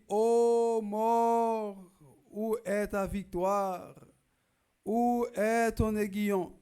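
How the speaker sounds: high, loud, drawn-out proclamation; crying out. Acted. Preacher quoting Paul to mourners, crying out. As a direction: cry it out as a defiant challenge to death. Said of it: "pour nous crier comme ça".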